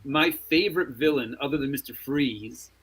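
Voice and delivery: ordinary talk, nothing marked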